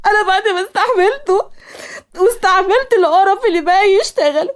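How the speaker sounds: high, tearful wail through sobs; crying outburst. A narrator's reading. Asmaa crying to Zeina about her fiancé, high tearful wail. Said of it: "وصل صوت عياط أسماء".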